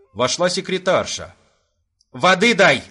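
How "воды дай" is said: loud urgent command; shout. Acted. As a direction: bark for water. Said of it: "крикнул ей Виноградов".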